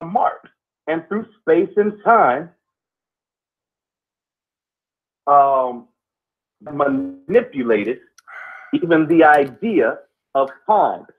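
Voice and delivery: slow, deliberate oration with long pauses; spoken-word pondering. Acted. Co-host expounds on history and time, slow deliberate oration. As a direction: weigh each phrase, pausing heavily between them. Improvised philosophical monologue on history.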